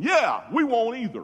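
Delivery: loud, high, eager agreement; group chiming in. Retold or imitated. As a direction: shout it like a whole crowd agreeing. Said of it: "they all spoke in".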